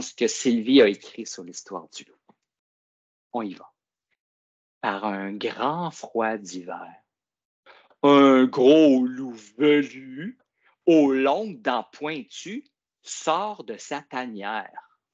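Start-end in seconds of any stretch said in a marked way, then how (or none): 4.8-6.9 slow, drawn-out storybook reading; story reading. A narrator's reading. Teacher reads story aloud. 8.0-10.4 slow and loud on loup velu; dramatic emphasis. A narrator's reading. Dramatic storybook wolf line. 10.8-15.0 slow, drawn-out storybook reading; story reading. A narrator's reading. Teacher reads story aloud.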